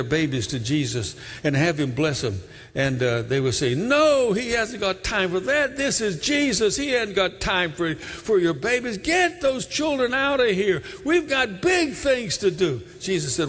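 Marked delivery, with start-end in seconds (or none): 3.7-12.8 disciples shooing. Acted. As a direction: bark them away like busy gatekeepers. Disciples shooing mothers away.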